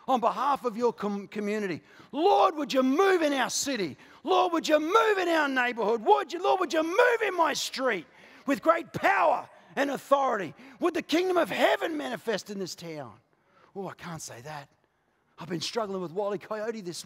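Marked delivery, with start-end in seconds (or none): 2.1-13.1 fervent shouted prayer. Acted. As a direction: plead with God at full voice. Acted prayer for the city.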